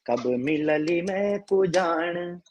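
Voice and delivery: ordinary delivery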